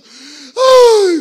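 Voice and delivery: loud, high, hammed-up wail; Orfa. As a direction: burst of fake crying, crocodile tears. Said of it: "dijo Orfa, se secó las lágrimas". (alarido de llanto fingido)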